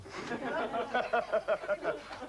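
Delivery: high, staccato cackle; acted laughing fit. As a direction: burst into a long high giggle. Voices Patozzo's laughing fit.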